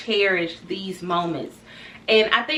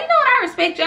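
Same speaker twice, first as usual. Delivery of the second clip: boss quote; 5 dB louder, much faster than usual. Retold or imitated. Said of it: "he always be like".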